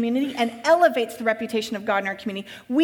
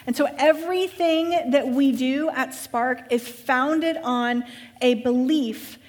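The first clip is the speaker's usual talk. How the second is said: ordinary talk, nothing marked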